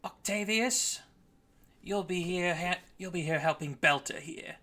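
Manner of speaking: a hint of a commanding shipmate; Nail giving orders. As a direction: hand out the job in a light character voice. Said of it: "She stops here and says".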